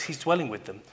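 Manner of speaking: usual speaking voice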